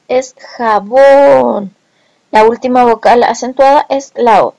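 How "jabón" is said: "jabón" with a long, drawn-out ó; stressed last vowel. Shown as a demonstration. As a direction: stretch the final o of "jabón". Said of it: "la última vocal acentuada de la palabra".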